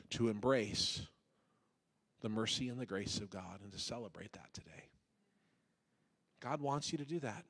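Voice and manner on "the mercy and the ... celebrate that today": soft whispered plea; hushed pastoral whisper. Acted. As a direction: breathe the line like a secret. Soft close of sermon plea.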